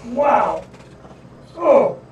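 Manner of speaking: groaning through a sudden cramp; real ows from a Charlie horse; leg cramp pain. Real, not acted. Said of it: "that hurts".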